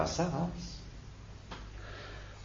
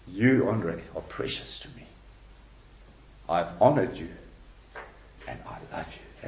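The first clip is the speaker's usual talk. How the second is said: low, soft, nearly whispered words from God; hushed gravity; 8 semitones lower, 7 dB quieter than usual. Retold or imitated. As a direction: let each phrase sink into a grave hush, with long pauses. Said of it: "really with such force and gravity".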